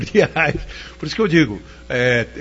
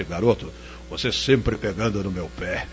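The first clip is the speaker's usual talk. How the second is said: much lower, slow cavernous growl; 7 semitones lower, 5 dB quieter than usual. Retold or imitated. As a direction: boom it out low and slow. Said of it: "com aquela voz cavernosa".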